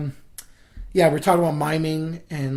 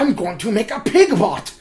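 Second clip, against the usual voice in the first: villain voice; 5 semitones higher, 5 dB louder than usual. Retold or imitated. Mock Eggman scheming.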